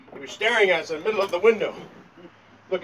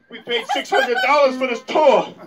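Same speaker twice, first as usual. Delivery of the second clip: higher, louder comic outrage; acted indignant outburst; 7 semitones higher, 6 dB louder than usual. Tourist protesting the ghost tour price, indignant. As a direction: complain loudly like a cheated customer.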